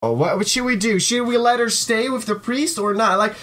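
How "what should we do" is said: normal talking voice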